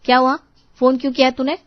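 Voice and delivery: loud, testy phone answer; irritated, angry. Acted. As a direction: answer the call in a temper. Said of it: "आज इतने गुस्से में क्यों". (गुस्से में, ऊँची आवाज़ में)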